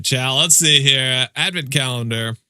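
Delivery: normal talking voice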